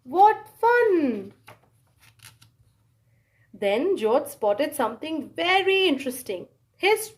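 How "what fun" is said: ordinary delivery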